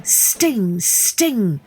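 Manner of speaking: usual speaking voice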